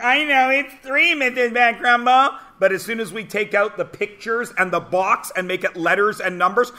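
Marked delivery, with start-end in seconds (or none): 0.0-2.4 high-pitched imitation of a keen little pupil; eager pupil. Pupils answering the kindergarten teacher, eager mock kid voice. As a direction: pipe up high, sure of the answer. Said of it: "everybody's like".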